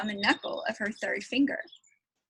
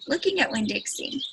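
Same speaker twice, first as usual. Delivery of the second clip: usual speaking voice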